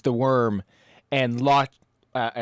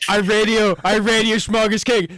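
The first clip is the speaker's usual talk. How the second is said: raised, urgent radio call; loud call; 7 semitones higher, 6 dB louder than usual. Acted. Player announces the radio call.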